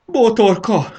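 high, loud desperate cry; shouting after her. A narrator's reading. Botor begs Botorka not to leave, high pleading shout. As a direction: cry out, drawing the plea out slowly. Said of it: "kiabált Botor".

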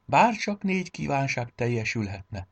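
wistful, high start sinking down; yearning, falling tune; wishful sigh. A narrator's reading. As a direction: sigh out a longing wish. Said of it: "sóhajtott fel Bizsú".

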